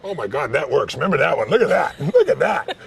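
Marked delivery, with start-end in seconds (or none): 1.5-2.9 high, loud, laughing; real delighted laugh. Real, not acted. Coach delighted at the smile.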